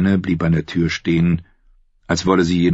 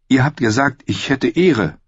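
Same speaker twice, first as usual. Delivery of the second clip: voiced higher as the young woman Brienne; shy woman knight voice, pitched up; Brienne's voice; 8 semitones higher than usual. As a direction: lift into Brienne's earnest higher voice.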